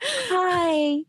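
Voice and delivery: high-pitched, put-on cheery dwarf; happy dwarf voice. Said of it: "I had to put on my happy voice".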